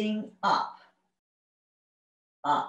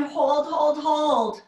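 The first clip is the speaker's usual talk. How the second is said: loud coaching; 6 semitones higher, 4 dB louder, much slower than usual. Real, not acted. Coach urging hold during exercise.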